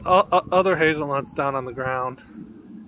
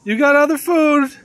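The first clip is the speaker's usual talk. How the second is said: high, loud pet-talk; pitched-up, projected call; 11 semitones higher, 10 dB louder than usual. Real, not acted. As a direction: call it out to an animal at a distance. Calling up to the squirrel.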